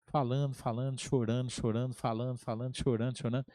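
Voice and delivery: low, soft repetitive droning; desabafo monótono. Retold or imitated. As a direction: reel it off evenly, as if it never ends. Imita seu desabafo interminável. (ladainha baixa e repetitiva, imitando um desabafo)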